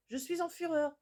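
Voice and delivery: mother at the top of her anger, furious. Retold or imitated. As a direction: announce the fury to the kids. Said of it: "je suis en fureur là".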